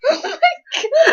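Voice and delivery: high, slow, laughing gasp of disbelief. Real, not acted. Co-host cracking up at the fan fiction, laughing. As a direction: crack up over the words.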